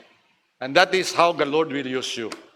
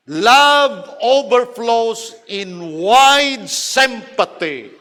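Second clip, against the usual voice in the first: preaching; 5 semitones higher, 6 dB louder, much slower than usual. Acted. Preacher's key line.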